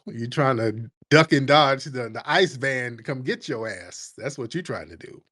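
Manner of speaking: ordinary talk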